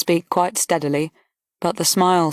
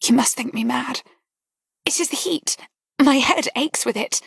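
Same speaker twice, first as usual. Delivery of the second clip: higher, half-whispered and unsteady; holding back tears in a hushed breath; hushed, tearful girl, higher; trembling voice; 7 semitones higher than usual. A narrator's reading. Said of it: "she said in a trembling voice".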